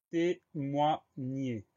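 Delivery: "témoigner" split into three long, separate syllables; slow dictation. Shown as a demonstration. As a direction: dictate "témoigner" very slowly, pausing between syllables. Dictates new word syllable by syllable.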